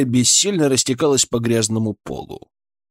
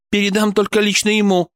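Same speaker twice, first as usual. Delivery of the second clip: higher-pitched, firm cut-off reply; curt refusal; 9 semitones higher than usual. Acted. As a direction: cut them off, pitch raised. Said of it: "отрезала Борисова". (отрезать, твёрдо и высоко)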